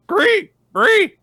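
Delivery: two loud, very high imitated animal squeals; distressed tapir squeal. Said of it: "the sound that, that tapirs make when they're in distress".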